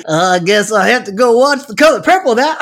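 hammed-up hillbilly echo. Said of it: "I'm making you a hillbilly".

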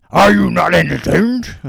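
loud, slow, booming challenge to the crowd; movie shout. Acted. Quizzing a friend with a slow booming Gladiator shout. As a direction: roar it at the arena like a gladiator.

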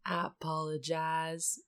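lazy cowboy line delivery; cowboy drawl. Retold or imitated. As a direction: say it like a movie cowboy. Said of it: "Is that a Matthew McConaughey line?".

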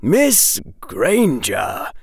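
slow, drawn-out grave address; gravely, drawing out the name. A narrator's reading. Professor Quirrell addressing Miss Granger, gravely. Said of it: "Professor Quirrell said gravely".

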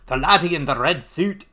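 mock Scottish villain; Scottish accent. Crossbow assassin meeting his foe, Scottish accent. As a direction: put on a Scottish brogue. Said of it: "I don't know why he's Scottish".

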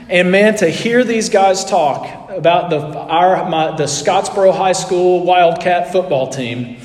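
ordinary talk, nothing marked

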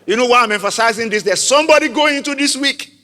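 high, loud, rising preacher's cry; preaching at full force. Acted. Preacher urging the congregation to declare it, loud and high. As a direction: build the crowd up to a peak.

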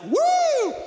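very high, loud whoop; imitated whoop. Brother cheering up from the water after the jump, whooping. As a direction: whoop with triumph from the water below. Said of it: "he's like".

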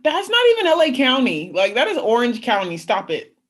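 loud, high, exasperated correction; mock indignant. Correcting someone who calls Orange County LA, mock indignant. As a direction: push back loudly, dropping in pitch at the end. Acted retort to false claim.

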